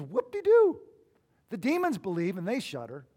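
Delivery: mock them in a put-on squeal; sarcastic. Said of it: "he's being sarcastic".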